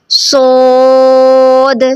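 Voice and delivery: सोद slowly drawn out, ending on a clear द; heavy saad. Shown as a demonstration. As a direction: stretch सोद and close on द. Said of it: "last में द".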